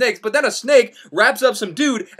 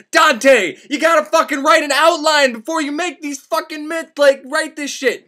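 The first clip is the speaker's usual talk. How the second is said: scolding Dante; 7 semitones higher than usual. Acted. Yelling at Dante about outlining his myths, exasperated scolding. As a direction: chew out the author like a bad student.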